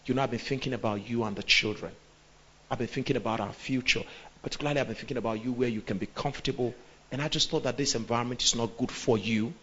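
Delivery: soft, gentle coaxing; husband coaxing his wife about moving to Ghana; sweet-talking husband. Shown as a demonstration. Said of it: "you're gonna come".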